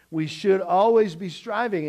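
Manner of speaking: ordinary talk, nothing marked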